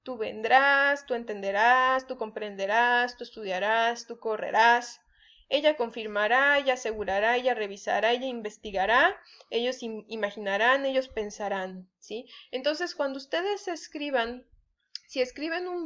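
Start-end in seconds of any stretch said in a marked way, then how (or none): 0.0-11.9 each verb from "vendrás" to "pensarán" stressed on its last A; stress on final A. Shown as a demonstration. Teacher listing future verbs, final A stressed as in "confirmará". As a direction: recite the list, leaning on every final A as in "entenderás". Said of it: "llevan acento en la última A".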